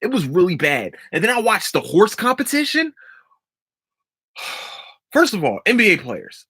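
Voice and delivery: usual speaking voice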